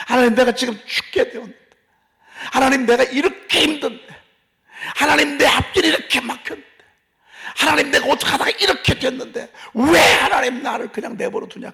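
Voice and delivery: loud, high-pitched anguished shouted plea; crying out to God. Acted. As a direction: shout a desperate complaint up to God, building. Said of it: "이게 부르짖는 거예요". (하나님께 부르짖는 절박한 외침)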